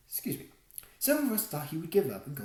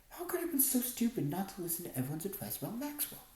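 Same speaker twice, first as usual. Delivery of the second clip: ordinary delivery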